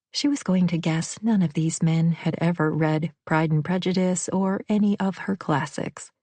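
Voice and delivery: usual speaking voice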